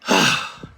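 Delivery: loud breathy stress sigh; exasperated exhale. Shown as a demonstration. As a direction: let out a big frustrated sigh. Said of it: "the analogy of the stress sigh".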